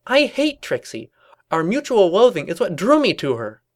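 high, emphatic cartoon protest; indignant Twilight. Twilight declaring mutual loathing, high indignant protest. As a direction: insist on it, loud and high.

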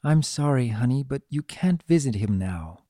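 plain reading voice

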